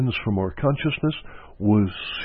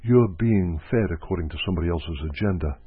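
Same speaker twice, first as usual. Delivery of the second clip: ordinary talk, nothing marked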